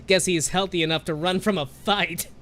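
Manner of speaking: loud, high jeering; gleeful mockery. A narrator's reading. Grandmother jeering after the fleeing bully, loud glee. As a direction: crow at the boy running off. Old woman mocking fleeing boy.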